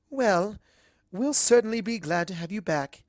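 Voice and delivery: a hint of elegant lady; gracious, a touch refined; lightly voiced Rarity. Rarity welcomes Twilight back, a hint of elegant lady. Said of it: "says Rarity".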